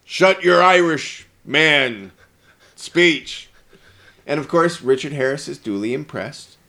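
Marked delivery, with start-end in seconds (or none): 0.0-3.5 mock patriotic rant. Mock Harrison Ford rant.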